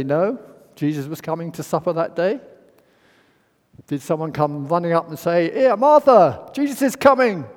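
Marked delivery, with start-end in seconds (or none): 5.5-7.5 high, loud urgent announcement; excited call. Retold or imitated. Messenger rushing to tell Martha, high loud excited call. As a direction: call out breathlessly that Jesus is coming.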